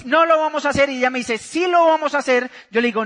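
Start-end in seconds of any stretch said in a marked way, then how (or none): 0.1-0.9 raised-voice refusal tripping over itself; acted quarrel. Husband mixes up his refusal to his wife, raised voice. 1.4-2.5 higher-pitched emphatic retort; acted insistence. Wife repeats her demand to husband, higher and insistent.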